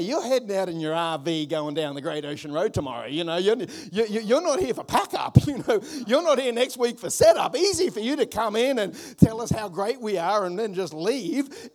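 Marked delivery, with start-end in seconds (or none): none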